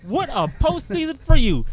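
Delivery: teasing a friend with mock excitement, high and slow; mock-excited. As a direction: rave at him slowly with teasing enthusiasm. Teasing Jared about his team.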